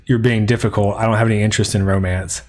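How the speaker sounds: ordinary talk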